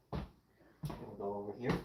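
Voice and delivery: ordinary talk, nothing marked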